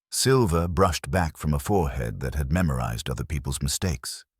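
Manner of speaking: plain reading voice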